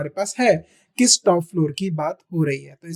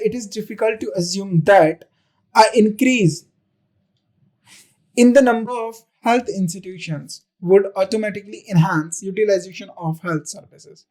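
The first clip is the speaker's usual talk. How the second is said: each word read slowly and deliberately for the class; a teacher reading the question to students, slow dictation; 4 dB louder, much slower than usual. Shown as a demonstration. Teacher reads exam sentence aloud.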